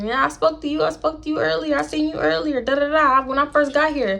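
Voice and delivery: lightly voiced guy insisting; imitating him. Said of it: "He was like".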